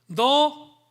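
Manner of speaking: booming commanding voice of God; God sternly ordering Job; voicing God. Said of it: "이렇게 말합니다".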